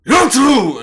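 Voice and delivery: loud, high summoning yell; commanding shout. Acted. Leader summons two underlings from the other side, commanding shout. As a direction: shout it across a cavern. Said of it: "shouts towards the other side".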